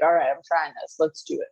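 lightly voiced old lady; a hint of a frail granny. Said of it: "That was my old lady voice".

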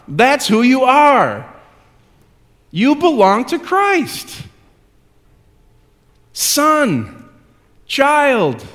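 high, loud, rising preacher's declaration; preaching at full force. Acted. Preacher assuring the congregation of who they are. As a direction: proclaim it to the whole room.

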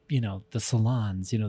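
normal talking voice

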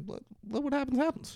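a squeaky hammed-up quote of the deputy; high mock voice. Retelling the deputy agreeing with the agent, high mock voice. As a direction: pitch way up on let what happens happens.

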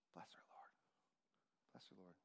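soft whispered blessing; hushed prayer whispered over a kid. Retold or imitated. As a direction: breathe the prayer under your breath.

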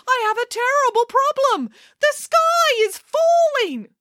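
very high wail of alarm; panicked chicken. A narrator's reading. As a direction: plead hysterically in a tiny chicken voice. Chicken Little panics to queen.

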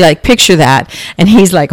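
ordinary talk, nothing marked

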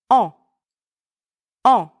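"en." as one nasal French vowel. Shown as a demonstration. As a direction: say "en." as a nasal "on", n unsounded. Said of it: "two ways to pronounce the letters E N".

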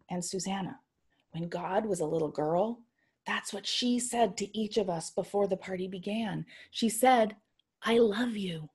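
soft whisper in her ear. Retold or imitated. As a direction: whisper to a sleeping child. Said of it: "I whispered in her ear".

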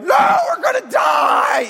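put-on high, loud panicked child's cry; terrified scream. Pastor mimicking his terrified little boys in the storm, a loud panicked shriek. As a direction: shriek it like a kid sure he'll die. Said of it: "they were terrified".

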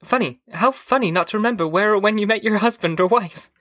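high-pitched wife, oddly amused; wife's voice lifted high after a little laugh; voice going up. A narrator's reading. Wife amused at forgetting how they met, high voice. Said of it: "went up and up".

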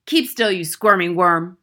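loud, harsh order; scolding shout. A narrator's reading. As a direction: shout down a squirming pupil. Headmistress scolding dangling boy.

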